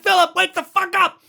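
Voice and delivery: high, loud yell at a sleepwalker; desperate yell at the movie character; shouting at the screen. Retold or imitated. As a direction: yell at him to wake up. Yelling at the screen.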